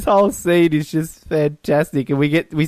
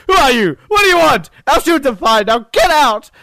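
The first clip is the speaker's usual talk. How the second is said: hammed-up irritated scientist, louder; mock angry rant; 7 semitones higher, 5 dB louder than usual. Podcaster quoting [name] throwing [name] out, louder and higher. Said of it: "he's just like".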